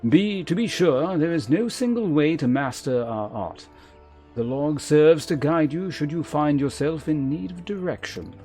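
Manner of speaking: formal, measured mentor with deliberate emphasis; lancer guildmaster. Acted. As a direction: instruct a pupil with grave authority. Voices the lancer guildmaster's dialogue.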